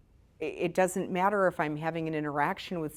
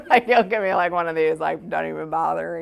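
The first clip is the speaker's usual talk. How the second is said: laughing through words; 10 dB louder than usual. Real, not acted. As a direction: talk through a burst of laughter. Laughing while imitating partner's reaction.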